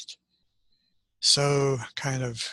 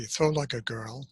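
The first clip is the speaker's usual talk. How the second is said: ordinary delivery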